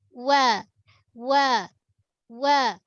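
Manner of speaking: "Wa" with both lips rounded, thin and light; waw sound demo. Shown as a demonstration. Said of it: "waw ni menggunakan dua bibir".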